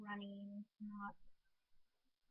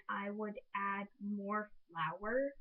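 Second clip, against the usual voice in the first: slow; much slower than usual. Real, not acted. Slowed closing advice.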